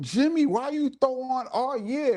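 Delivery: high-pitched incredulous retelling of a heckle; imitated ribbing, pitched well up; teasing complaint. Retelling Igard's amused complaint.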